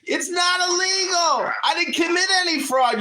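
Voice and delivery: yelling at full force; loud sharp voice. Real, not acted. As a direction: shout it down at him. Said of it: "Don't be screaming up your head".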